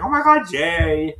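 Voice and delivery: very high, loud imitation of overjoyed sisters; mock excited squeal. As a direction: squeal it like they're celebrating. Acting out sisters' overjoyed reaction.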